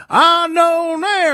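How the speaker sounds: put-on slow, high Southern accent drawl; hammed-up Southern accent imitation; mock Southern drawl. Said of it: "this Southern accent draw".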